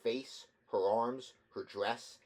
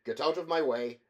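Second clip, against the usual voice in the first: angry order; 4 semitones higher, 7 dB louder than usual. A narrator's reading. Engineer pushes past, angry.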